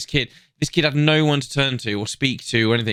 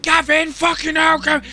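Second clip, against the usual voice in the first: imitated shout; 4 dB louder than usual. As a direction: mimic the man shouting in the clip. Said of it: "you can hear the guy shout".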